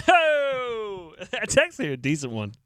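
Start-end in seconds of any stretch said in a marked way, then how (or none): none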